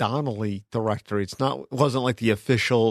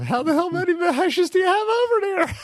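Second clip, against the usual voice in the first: imitating his uncle. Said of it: "like my uncle said".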